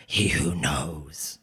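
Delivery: very low, slow creature voice; giant metal being. Acted. Giant being names Kavoris, deep and slow. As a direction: intone it slowly, deep down.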